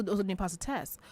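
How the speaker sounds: ordinary talk, nothing marked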